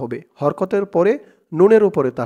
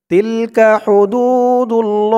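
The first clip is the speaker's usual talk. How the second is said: slow, drawn-out recitation of Tilka হুদুদুল্লাহ; recited verse; 5 semitones higher, 5 dB louder, much slower than usual. Shown as a demonstration. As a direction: chant Tilka হুদুদুল্লাহ slowly with long vowels. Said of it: "তিন আলিফ টান মধ্যে আরজি". (ধীর সুরে তিলকা হুদুদুল্লাহ তিলাওয়াত)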